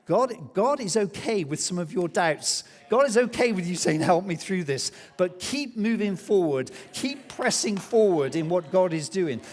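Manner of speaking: loud, high, driving preacher's exhortation; preaching at full force. Acted. Pastor urging the church onward, preaching at full force. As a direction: press it on the congregation with full conviction.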